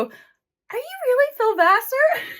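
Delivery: high, loud, slow starstruck squeal; starstruck fangirl gush. Retold or imitated. Telling viewers how she met [name], gushing starstruck. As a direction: gush like a starstruck fan meeting her idol. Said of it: "I totally fangirled".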